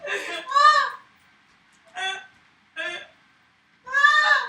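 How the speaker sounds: high, loud laugh-crying shrieks; frantic screams. Real, not acted. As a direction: scream over and over. Screaming in breakdown.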